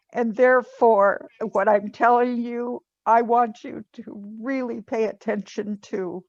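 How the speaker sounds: ordinary delivery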